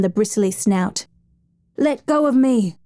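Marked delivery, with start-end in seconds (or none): 1.8-2.8 higher, strained cry; desperate shout. A narrator's reading. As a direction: fight back with the voice. Fighting the hippo underwater.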